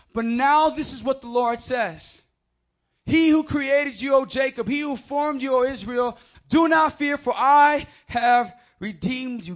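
loud, high, slow proclaimed scripture; preaching at full force. Acted. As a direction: shout the verse out like a proclamation. Rereads verse at full force.

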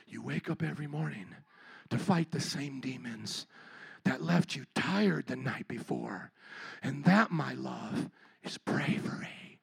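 a sappy inspirational meme voice, hushed; mock soothing quote. Pastor mocking a soothing meme, low and hushed. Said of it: "what they mean by demons".

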